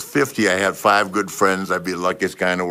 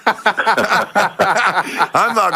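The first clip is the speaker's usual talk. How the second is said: laughing; 11 semitones higher, 3 dB louder, much slower than usual. Real, not acted. Laughing while boasting.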